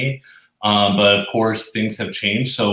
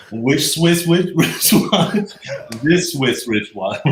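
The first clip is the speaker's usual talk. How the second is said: tongue twister attempt; 6 semitones higher, slower than usual. Real, not acted. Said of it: "I'll see if I can even do it".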